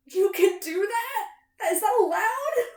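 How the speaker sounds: high, slow, astonished questions; stretched, high-pitched fan astonishment; incredulous amazement. Retold or imitated. Retold amazement at seeing the scene.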